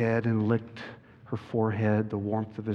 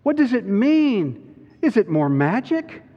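put-on high, raised girl's voice; louder, high-pitched pleading questions; bewildered Susan; 9 semitones higher, 5 dB louder than usual. A narrator's reading. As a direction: voice a distressed girl begging for answers. Susan's distressed questions in story.

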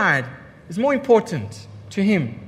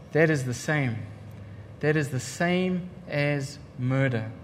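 quiet solemn verdict; 6 semitones lower, 8 dB quieter than usual. Retold or imitated. Voicing Jesus' verdict.

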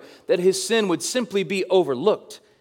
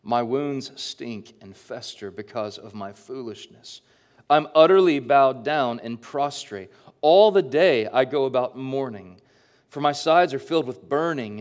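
scripture reading; 3 semitones lower than usual. Acted. Reads psalm aloud.

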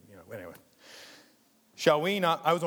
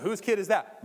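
onlookers asking; 9 semitones higher, 9 dB louder than usual. Retold or imitated. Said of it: "Everyone's looking at me".

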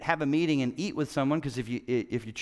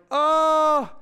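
much higher, louder shocked gasp of a word; 11 semitones higher, 8 dB louder than usual. Retold or imitated. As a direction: hit it like bad news landing. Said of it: "I was like".

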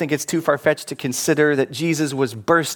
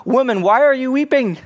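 high, louder call of the angels; angels asking; 10 semitones higher, 5 dB louder than usual. Acted. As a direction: lift the voice for the angels' question. Angels question weeping Mary.

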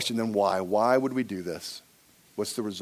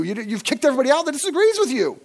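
fast, high, raised-voice comeback; exasperated retort; 13 semitones higher, 7 dB louder, faster than usual. Acted. Preacher answering a church that boasts no division, exasperated retort. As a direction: fire it back much higher and louder.

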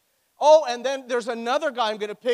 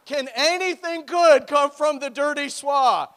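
louder, slow, playfully incredulous; mock disbelief; 5 semitones higher, 7 dB louder, slower than usual. Preacher teasing his own hometown, in mock disbelief. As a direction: drag it out like a scandalised local. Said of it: "Listen, I am from Oshawa".

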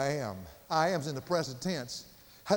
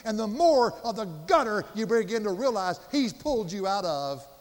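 raised, louder pulpit voice; impassioned preaching; 4 semitones higher, 5 dB louder than usual. Real, not acted. Preacher warning believers about the pit they came from, impassioned. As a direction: drive each phrase home with force.

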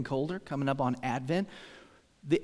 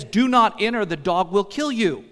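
raised, louder voice quoting a warning sign; emphatic warning; 5 semitones higher, 8 dB louder than usual. Retold or imitated. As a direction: lean hard on the warning, pitch up.

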